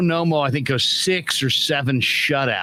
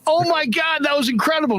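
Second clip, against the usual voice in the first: excited gushing; 13 semitones higher than usual. Retold or imitated. Friends rushing up to Joe after the walk-off, excited gush. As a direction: rave about the game like you just saw a miracle.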